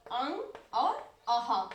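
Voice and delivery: अंग और अह said slowly, each sound set apart; slow articulated demo. Shown as a demonstration. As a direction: spell out अंग और अह slowly for students. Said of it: "क्या है?".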